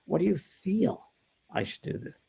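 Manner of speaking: slow, drawn-out question fading to a whisper; slow them down by drawing each word out; unhurried, calming question. Shown as a demonstration. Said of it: "Slow them down".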